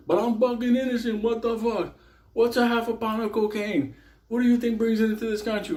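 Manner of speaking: high indignant protest; whiny inmate. Retold or imitated. Inmate protesting his innocence in a high indignant whine. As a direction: squeal it like an outraged prisoner.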